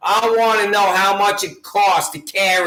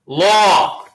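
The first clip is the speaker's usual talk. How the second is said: "Law." said louder as one hard word; punched single word; 2 semitones lower, 4 dB louder than usual. Shown as a demonstration. Hammering the word law home to the sheriff, louder. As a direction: hit "Law." as its own beat. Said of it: "The key word is law".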